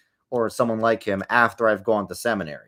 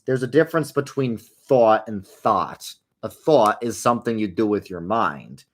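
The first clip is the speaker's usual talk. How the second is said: ordinary talk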